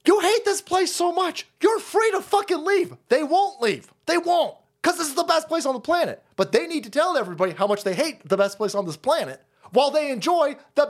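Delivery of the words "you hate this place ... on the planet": high-pitched indignant tirade; indignant rant. Real, not acted. Host telling haters they are free to leave, indignant. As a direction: throw it in their faces, exasperated.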